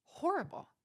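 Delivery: "Horrible" lifted high and set apart for weight; emphatic repeat. Real, not acted. Vlogger stressing how badly the boss treats you, emphatic. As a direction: land hard on "Horrible" after a long pause. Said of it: "your boss treats you horrible".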